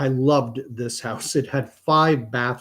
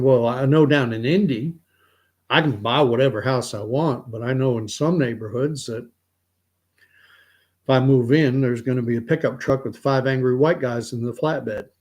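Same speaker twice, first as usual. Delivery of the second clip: ordinary delivery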